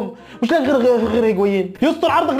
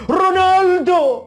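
calling out; 6 semitones higher, slower than usual. Real, not acted. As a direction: call him across the pitch. Calling out to Ronaldo.

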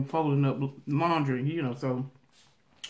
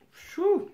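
whoosh. Retold or imitated. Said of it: "she was guilt going off like".

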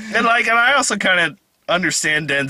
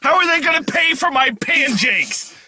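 mock stingy seller; 10 semitones higher than usual. Mock greedy pancake seller.

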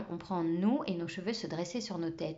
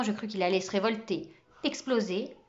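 ordinary delivery, nothing marked

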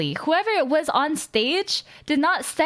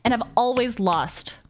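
ordinary delivery

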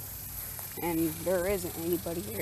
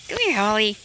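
calling a cat; 3 semitones higher, 5 dB louder than usual. Real, not acted. Child calls the cat to come here, calling out. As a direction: call the pet over.

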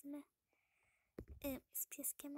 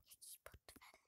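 whisper; 17 dB quieter than usual. Real, not acted. Whispering while exploring a shop.

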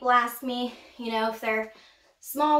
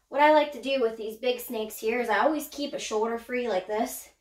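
ordinary talk

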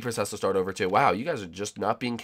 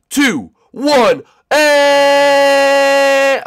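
rising, louder countdown call; countdown climax; 13 dB louder, much slower than usual. Real, not acted. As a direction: build the count up to a yelled launch. Dramatic race countdown.

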